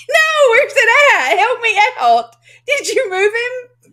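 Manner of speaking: high, loud, laughing mock distress; mock panic. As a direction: play frantic, half laughing, pleading for help. Mock panic over missing Dave on screen.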